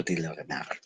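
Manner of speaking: usual speaking voice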